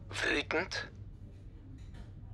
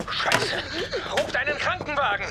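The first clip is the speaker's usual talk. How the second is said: high, fast, panicked yell; panicked shout; 12 semitones higher than usual. Acted. Joke bricht zusammen, Panik. (panisch geschrien)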